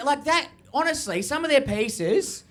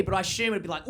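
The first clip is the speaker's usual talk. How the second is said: usual speaking voice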